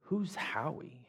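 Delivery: soft, slow, half-whispered question; leaned-in question. Retold or imitated. New guy leaning over in church, asking softly and slowly. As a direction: lean in and ask it under your breath. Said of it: "he leans over to me and he says".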